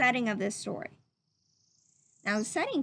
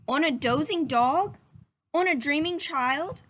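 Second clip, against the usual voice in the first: put-on reading to children; 3 dB louder than usual. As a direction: read it gently to little listeners. Reading picture book to children.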